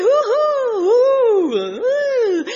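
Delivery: high, loud gleeful whoops; ranita feliz. Acted. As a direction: whoop like a frog having a great time. Frog enjoying the warm pot. (gritos agudos de alegría)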